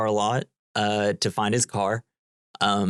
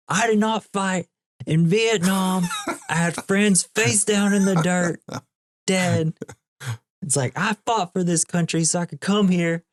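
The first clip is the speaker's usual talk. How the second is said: high-pitched, worked-up imitation of an angry rant; retold outburst, pitched way up but not full volume; mock yelling; 9 semitones higher than usual. Ranting diner customer told to stop yelling, mock yell. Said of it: "then he starts yelling".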